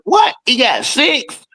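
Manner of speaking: squeaky high, slowed "He got six?"; shocked disbelief. Retold or imitated. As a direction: act stunned by the fact. Shocked at the real fact.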